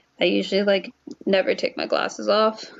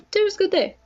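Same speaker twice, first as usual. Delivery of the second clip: bright reply; 11 semitones higher, faster than usual. Acted. Character answers brightly.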